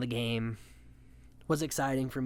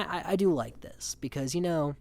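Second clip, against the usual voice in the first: normal talking voice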